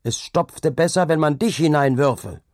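high, loud threat; angry shout. A narrator's reading. Laborer shouts a threat at Hauke, loud and angry. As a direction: hurl the threat at him. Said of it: "schrien Hauke an".

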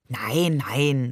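low, calming "Nein, nein"; father calms the worried mother, soothing and low. A narrator's reading. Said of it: "sagte Barbe beschwichtigend".

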